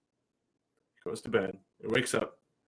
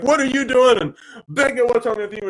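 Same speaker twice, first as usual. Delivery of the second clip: high, loud startled yell; alarmed outburst; 10 semitones higher, 7 dB louder than usual. Retold or imitated. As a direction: call out in shock at the scene. Parent reacts to tantrum.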